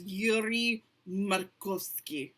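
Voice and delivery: slow, hammed-up Russian accent; mock Russian accent. Host introducing the cast in a joke Russian accent. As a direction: drawl the name in a bad Russian accent. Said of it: "That's a horrible Russian accent".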